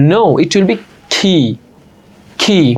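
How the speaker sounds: key with a breathy aspirated k; slow it down and push air on key; key with a strong puff of air. Shown as a demonstration. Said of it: "কি বলা যাবে আজকে থেকে".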